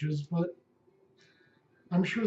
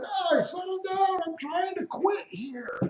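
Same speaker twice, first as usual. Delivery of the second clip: far higher, slowed mock yell of an old Pope; mock screaming Pope; slower than usual. Pope yelling at the flapping cardinals, much higher and slow. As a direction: wail it like a frazzled old man losing control. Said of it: "He's screaming".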